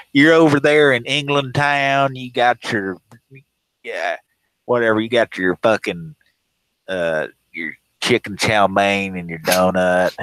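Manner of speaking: hammed-up yokel mocking the British; mock ignorant caller. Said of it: "He'd be like".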